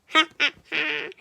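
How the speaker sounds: big loud ha ha ha; roaring laugh. A narrator's reading. Dragon being tickled, roaring laugh. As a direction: roar with laughter. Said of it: "roared the wood dragon".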